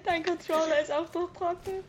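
incredulous question. Real, not acted. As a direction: ask back in disbelief. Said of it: "Ich meine, der Controller".

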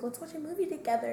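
quick, high, eager invite; excited. Retold or imitated. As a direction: pitch it up fast and eager. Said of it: "was like".

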